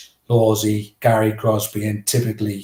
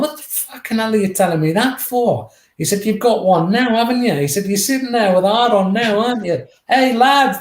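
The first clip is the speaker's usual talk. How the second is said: much higher, louder mocking taunt; Roy mocking; 11 semitones higher, 4 dB louder than usual. Roy turns on the teammate defending him, high mocking taunt. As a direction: taunt him in front of the lads, pitched way up.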